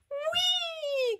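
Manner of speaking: very high, long whee; drawn-out soaring squeal; joyful cry. Acted. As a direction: squeal like a child on a ride. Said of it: "cried Peppa".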